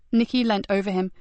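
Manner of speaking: ordinary delivery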